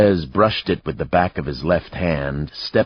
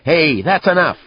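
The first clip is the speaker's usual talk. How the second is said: high, loud shout of protest; older man's yell; 13 semitones higher, 7 dB louder than usual. A narrator's reading. Older patron stepping in on a bar fight, yelling. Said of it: "an older man yelled".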